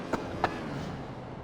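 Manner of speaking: a dry real cough mid-sentence. Real, not acted. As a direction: clear it out with a cough. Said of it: "Cough, cough".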